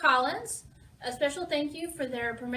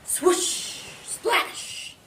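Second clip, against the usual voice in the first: sound-effect words; 5 semitones higher than usual. A narrator's reading. Storyteller voicing the ship in big waves, whooshing sound effects. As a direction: stretch the hiss like a breaking wave.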